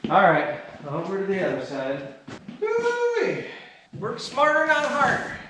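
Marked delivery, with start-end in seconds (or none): 2.6-3.5 woo-wee exclaim. Real, not acted. Exclaiming at dust cloud.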